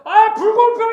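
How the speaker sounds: very high, loud yell of protest; full-voiced shout. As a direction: scream it out in outrage, acting it out. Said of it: "소리 지르면서". (억울해서 내지르는 고함)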